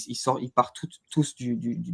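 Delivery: usual speaking voice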